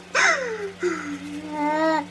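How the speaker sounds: sharp gasp of pain. Real, not acted. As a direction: suck in air from the sting. Mask peeling off skin.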